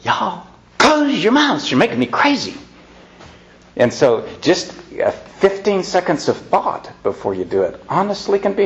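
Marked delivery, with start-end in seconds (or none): 0.0-2.6 exasperated snap. Shown as a demonstration. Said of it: "to just say".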